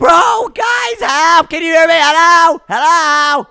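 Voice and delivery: high, loud distress call; yelling for help. Acted. Trapped patient yelling to his friends for help. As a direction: yell to be heard through walls. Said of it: "Stop yelling".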